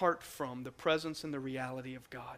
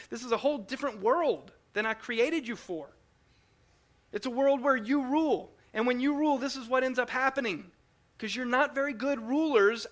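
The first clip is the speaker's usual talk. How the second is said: rising, louder preaching as God speaking; impassioned sermon voice; 4 semitones higher, 4 dB louder than usual. Acted. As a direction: preach it with growing force. Preaches God's words.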